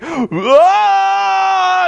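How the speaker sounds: high shouted mock power-up yell; power-up scream. Abyss breaking his cuffs, hammed-up scream. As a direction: summon all your strength in one roar. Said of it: "And he's like".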